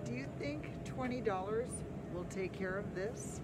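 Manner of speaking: usual speaking voice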